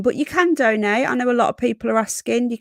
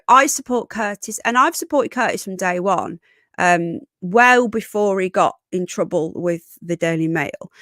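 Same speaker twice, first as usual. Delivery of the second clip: ordinary delivery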